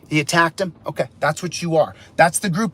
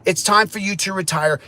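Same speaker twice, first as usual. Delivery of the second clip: ordinary delivery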